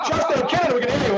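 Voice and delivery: high laughing reassurance. Real, not acted. Laughing after a joke.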